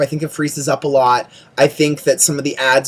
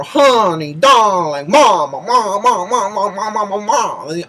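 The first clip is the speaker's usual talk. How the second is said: mocking drag queen; 3 semitones higher than usual. Mocking new queens' lingo.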